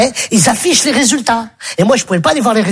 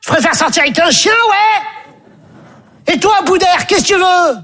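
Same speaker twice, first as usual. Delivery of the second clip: much higher, scornful half-shout; imitating Stéphanie; 7 semitones higher than usual. Stéphanie rejecting the boy, mocking him. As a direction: brush him off with disdain. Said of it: "Elle dit".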